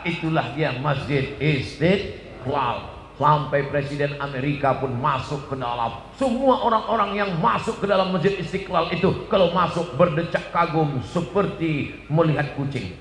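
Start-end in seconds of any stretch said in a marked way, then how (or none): none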